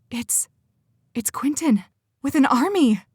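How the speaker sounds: alarmed outburst. Acted. Jocelyn warns the others an army is outside, alarmed and rising. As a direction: blurt out the terrible news, voice climbing.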